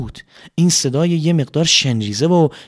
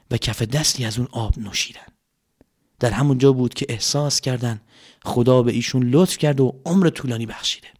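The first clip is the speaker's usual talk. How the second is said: ordinary delivery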